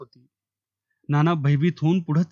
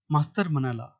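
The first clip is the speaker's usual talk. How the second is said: usual speaking voice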